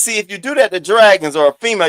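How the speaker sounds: ordinary talk, nothing marked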